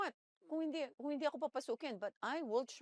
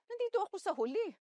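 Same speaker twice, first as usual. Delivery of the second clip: higher and louder, protesting; indignant outcry; 9 semitones higher, 7 dB louder than usual. Retold or imitated. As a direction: cry out that it isn't fair. Indignant at being last. (pasigaw na pagtutol)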